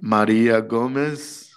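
slow mock-Russian raffle call; bad Russian accent. Man calling the raffle winner on the microphone, bad Russian accent. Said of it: "here's my bad Russian accent".

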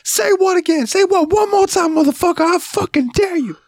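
very high-pitched mock shouting; angry movie threat. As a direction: dare them, hammed-up and furious. Acted Pulp Fiction threat.

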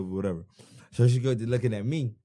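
normal talking voice

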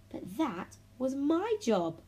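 high, slow huffy protest; indignant huff. A narrator's reading. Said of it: "huffed Emily".

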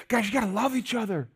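pleading insistence. Retold or imitated. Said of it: "keeps saying over and over".

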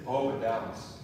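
soft, low, tender retelling; gentle consoling. Retold or imitated. Mother consoling her crying little boy, retold softly and gently.

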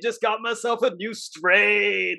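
slow, drawn-out stoner voice; Jonah. Retold or imitated. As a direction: imitate a stoned dude telling news. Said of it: "You sound like you're high".

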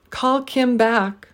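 Call, Kim, back each stressed slowly, back stretched up then down; slow three-beat Call Kim back; deliberate stress on every word. Shown as a demonstration. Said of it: "stress three syllables in each phrase".

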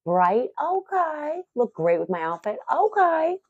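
high sing-song rising okays; convincing herself. Retold or imitated. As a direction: talk yourself into it, each okay climbing high. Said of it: "And I'm like".

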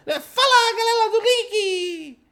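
high, slow, put-on Chinese-style channel intro; mock Chinese accent greeting the channel's fans. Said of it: "Imagina eu anunciando o Detonando Geek".

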